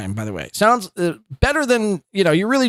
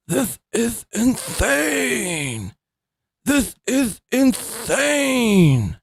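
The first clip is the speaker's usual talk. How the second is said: very slow, higher, stretched mockery; slowed playback voice; 7 semitones higher, much slower than usual. Shown as a demonstration. Host mocking the streamer's voice at quarter speed. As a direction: stretch "This is insane" to a crawl. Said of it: "this is legitimately how I hear Patrick's voice".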